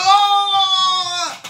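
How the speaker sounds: a high, loud, delighted "Oh!"; excited shout. Retold or imitated. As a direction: shout it out like a thrilled fan. Owner reacts again, excited.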